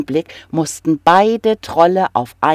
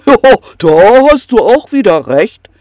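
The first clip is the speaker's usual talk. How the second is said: snorting troll; 5 semitones higher, 10 dB louder than usual. Acted. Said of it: "prustete der Troll".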